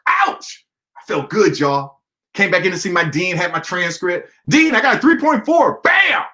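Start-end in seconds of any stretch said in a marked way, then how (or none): 0.1-0.7 excited ouch. Real, not acted. Celebrating grade jump. 4.5-6.2 high, loud shout; student slamming down his improved transcript; triumphant shout. Retold or imitated. Triumphant return to dean.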